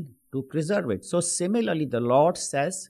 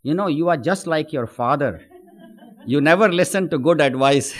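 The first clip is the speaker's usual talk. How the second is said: louder, mildly exasperated motherly scold; 3 semitones higher, 7 dB louder than usual. Acted. Mother scolds her son who ignored her warning, mild loud scolding. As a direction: tease him like a fond but fed-up mom. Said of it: "she might say".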